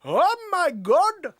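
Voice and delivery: slow, high mock Indian accent. Shown as a demonstration. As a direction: stretch out the comic Indian accent. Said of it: "When you're in the middle of doing a".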